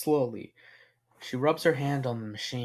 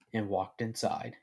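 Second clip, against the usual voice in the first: ordinary delivery, nothing marked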